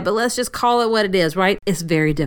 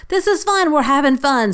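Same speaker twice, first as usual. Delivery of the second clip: high, chirpy self pep talk; forced cheer; 7 semitones higher, 3 dB louder than usual. Pretend. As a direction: fake it till it's fun, brightly. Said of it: "I have to say to myself".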